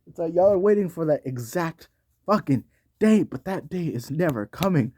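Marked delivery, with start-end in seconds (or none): none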